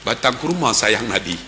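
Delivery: usual speaking voice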